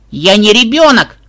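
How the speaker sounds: put-on high, loud child's protest; indignant. A narrator's reading. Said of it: "возмутилась внучка".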